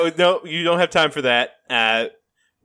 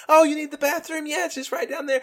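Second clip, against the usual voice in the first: friendly Job; 11 semitones higher, 3 dB quieter than usual. Retold or imitated. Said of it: "He's like".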